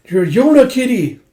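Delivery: high, loud burst of recognition; excited exclamation. Acted. Rescuer recognising the princess, excited exclamation.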